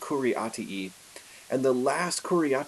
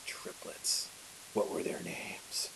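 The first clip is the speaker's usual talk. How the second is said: breathy whispered dread, slower; hushed whisper; 4 semitones lower, 10 dB quieter, slower than usual. Acted. Sister asks her brother in a frightened whisper. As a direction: whisper it as the truth dawns on her. Sister realizing fiance's fate.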